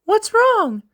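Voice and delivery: put-on very high girlish call to her brother; sister calling out. Soraeus arriving and calling her brother, high call.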